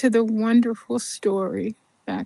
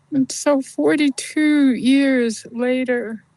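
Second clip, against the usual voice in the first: normal talking voice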